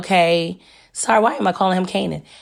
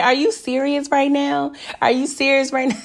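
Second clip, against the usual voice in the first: incredulous; 7 semitones higher than usual. Retold or imitated. Vlogger reacting to TV character.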